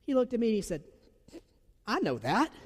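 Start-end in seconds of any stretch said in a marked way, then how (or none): 1.9-2.5 higher, as if it were obvious; matter-of-fact certainty. Retold or imitated. Boy answers the pastor about God, utterly matter-of-fact. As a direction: say it like the sky is blue. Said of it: "like it was the sky is blue".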